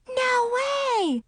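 high excited gasp of joy; excited girl. Acted. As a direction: squeal it out in disbelief. Friends shocked and happy.

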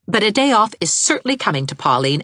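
usual speaking voice

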